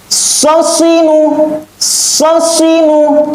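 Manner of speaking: high, crisp Quran letters; fast, firm recitation. Shown as a demonstration. Teacher shows pupils fast, firm letter reading, high and crisp. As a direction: snap out each syllable firmly. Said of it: "Ketika baca cepat".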